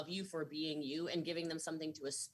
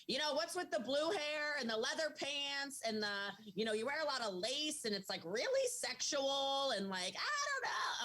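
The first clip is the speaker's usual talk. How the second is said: mocking critics; 6 semitones higher than usual. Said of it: "The people are like".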